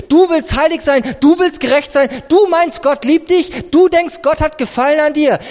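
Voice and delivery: high, rapid-fire taunting doubts; accusing challenge. Acted. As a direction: throw each claim back in disbelief. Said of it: "wenn wieder die Welt schreit". (vorwurfsvoll und ungläubig)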